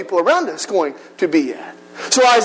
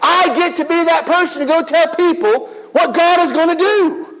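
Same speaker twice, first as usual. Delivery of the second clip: bubbling, higher voice; excited; 6 semitones higher than usual. Retold or imitated. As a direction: burst with eager joy. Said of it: "building with excitement".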